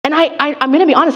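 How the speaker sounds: ordinary delivery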